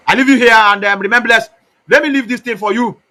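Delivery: calling out loud and high; loud sign-off. Real, not acted. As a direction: announce it to everyone loudly.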